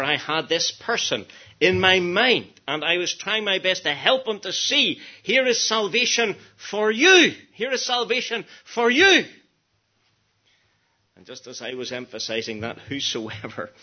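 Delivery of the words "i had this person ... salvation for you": loud, high pulpit appeal; fervent evangelist's proclamation; impassioned preaching. Real, not acted. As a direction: urge it on the listener, voice climbing. Preaching at full force.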